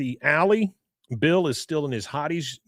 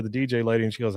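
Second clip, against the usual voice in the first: usual speaking voice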